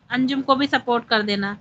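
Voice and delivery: ordinary delivery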